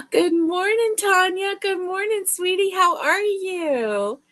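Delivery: high-pitched gushing hello after a gasp; sing-song high welcome, slowing at the end; delighted greeting. Real, not acted. Gushing greeting to arriving friend.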